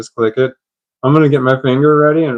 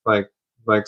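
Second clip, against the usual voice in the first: ordinary talk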